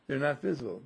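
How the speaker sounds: ordinary talk, nothing marked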